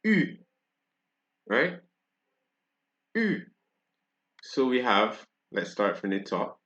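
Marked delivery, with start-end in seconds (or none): 0.0-0.4 U as rounded "ee", high pitched; French U sound. Shown as a demonstration. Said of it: "it is still pronounced". 3.1-3.4 U as rounded "ee", high pitched; French U sound. Shown as a demonstration. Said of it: "it is still pronounced".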